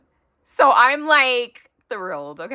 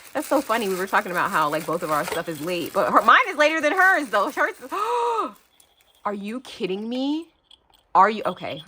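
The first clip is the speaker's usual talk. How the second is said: ordinary talk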